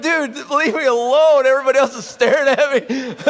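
embarrassed plea. Retold or imitated. [name] pleading with his teammate to stop, embarrassed. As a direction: beg him to stop, embarrassed. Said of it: "I'm like".

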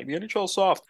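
high-pitched mock old-man grumble; mock old man complaining at the sky; old man yelling at sky. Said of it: "such old man yells at the sky".

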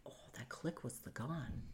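very soft, near-whispered thought; hushed realization. Retold or imitated. Woman realizing he set the gun down, hushed. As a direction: breathe it out as a private realization. Silent realisation while hiding in bathroom.

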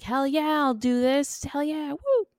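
high-pitched mock enthusiasm; imitating excited dad. Host quoting dad waking his daughter, excited high imitation. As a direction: whoop it up as the eager dad. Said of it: "her dad excitedly being like".

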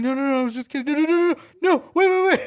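high, loud, rapid-fire pleading; mock panicked child. Kid sees mom being called, mock panicked protest.

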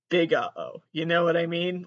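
normal talking voice

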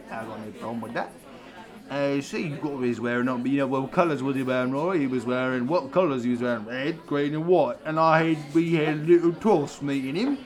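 swagger like a pub geezer telling a tale; hammed-up Cockney. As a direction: [name] the Londoner telling a pub story. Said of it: "Hey, it's me, [name]".

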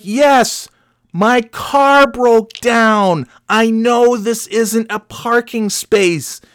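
high, slow, sugary sarcasm; mock sarcasm. Stranded driver thanks the stranger, high sugary sarcasm. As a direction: thank him with fake sweetness.

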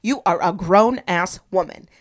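rally yourself like a coach giving orders; self pep talk. Retold or imitated. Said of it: "gave myself a little pep talk".

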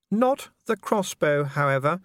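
high, slow and firm lady; a lady forbidding the crossbow; firm Lady Sybil. A narrator's reading.

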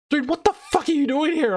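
high mock incredulous friend; shrill-free high friend's disbelief. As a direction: squeak it out in disbelief. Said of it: "And they're like".

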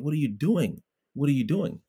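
retold plea, pitched high; pleading. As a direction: imitate an exasperated, high-pitched appeal. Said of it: "I'm pleading with them".

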